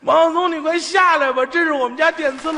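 high, loud pleading, begging him to step off; frantic plea. Retold or imitated. Wife begs Wang to step off the stove, frantic plea. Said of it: "当时他媳妇儿都跪下了".

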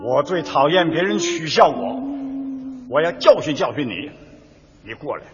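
low, stern dubbed villain voice; dubbing Oswaldo in standard Mandarin. Acted. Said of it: "你看奥斯瓦尔多先开口啊".

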